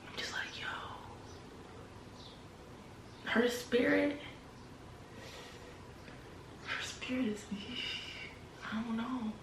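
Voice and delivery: whispered unease; barely voiced, uneasy; hushed whisper. Seeing mom's narcissist eyes, hushed whisper. Hushed about mother's spirit.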